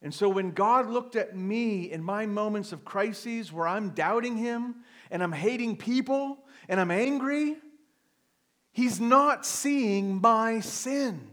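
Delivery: high, loud, slow preaching climax; fervent preaching. Acted. Pastor proclaiming grace to his congregation, fervent and slow. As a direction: lift each phrase high and drawn out, pleading.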